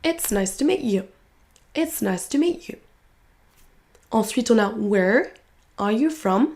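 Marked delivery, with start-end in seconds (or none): 0.0-2.8 "you" weakened to a short "ya"; reduced YOU. Shown as a demonstration. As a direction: swallow the vowel of "you" after stressed "meet". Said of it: "le you va être réduit souvent en y". (you réduit en y)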